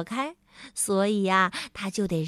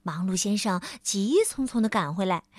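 ordinary delivery, nothing marked

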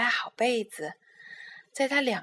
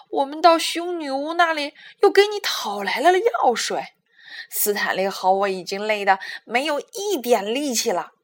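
loud, exasperated telling-off; loud angry rant, building to a cry; fed-up shouting; 10 dB louder than usual. A narrator's reading. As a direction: scold him loudly, at the end of her patience. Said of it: "艾美丽大叫".

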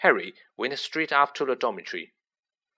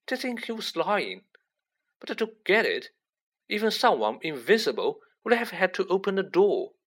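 high-pitched, doubtful reasoning; puzzled Ron; 7 semitones higher than usual. Acted. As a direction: puzzle it out in a higher voice. Figure of Ron puzzling in story.

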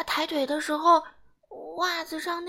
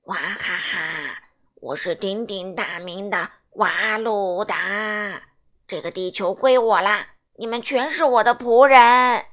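gloating space villain, growing louder; alien villain; 6 semitones lower, 7 dB louder than usual. Acted. Alien Waluda claiming the Earth, low gloating then booming.

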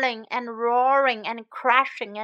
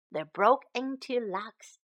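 ordinary delivery, nothing marked

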